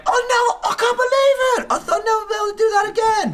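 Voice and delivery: very high, loud mock-excited squeal; mock freak-out. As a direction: imitate someone losing it in disbelief. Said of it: "he was, like, freaking out".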